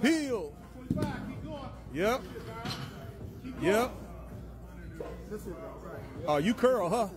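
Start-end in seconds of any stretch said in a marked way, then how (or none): none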